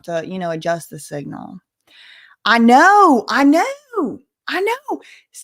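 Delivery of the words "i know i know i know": excited. Real, not acted. Huge donation reaction.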